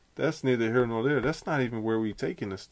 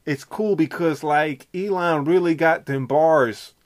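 higher, loud and slow brag; 6 semitones higher, 8 dB louder, slower than usual. Pretend. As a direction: stretch it out like a rapper. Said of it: "Am I doing it?".

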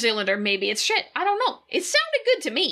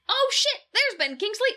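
mock surprise; 7 semitones higher than usual. Retold own surprise watching film.

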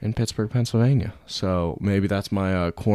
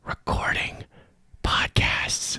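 rueful whisper; 4 semitones lower, 3 dB louder than usual. Pretend. Lamenting quarantine.

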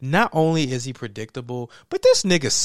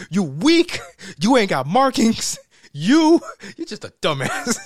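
louder mock scolding, laughing through; hammed-up scolding rant; mock Raiden rant; 4 semitones higher, 4 dB louder than usual. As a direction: scold them like a fed-up god.

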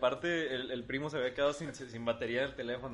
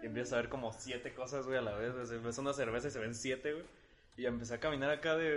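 ordinary talk

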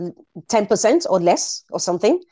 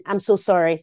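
usual speaking voice

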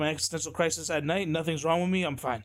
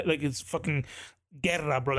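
usual speaking voice

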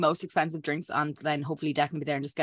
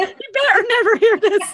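laughing; 7 dB louder, slower than usual. Real, not acted. Laughing warning about voice note.

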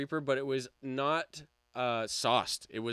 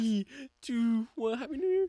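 new year countdown crowd; 10 semitones higher than usual. Real, not acted.

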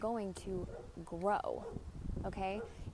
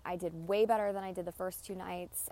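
ordinary talk, nothing marked

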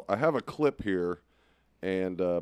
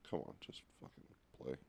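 muttering; 10 semitones lower, 19 dB quieter, faster than usual. Real, not acted. Muttering at a stuck video.

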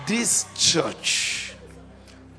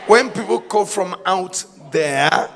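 preaching at full force; 2 semitones higher, 10 dB louder than usual. Acted. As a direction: thunder it over the congregation.

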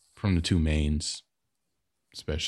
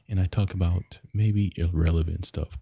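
normal talking voice

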